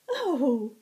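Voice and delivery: high, loud, playful fae tease; teasing high herald. Acted. Shinnah teasing the blushing Zack, high and playful. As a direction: tease him, lilting up high.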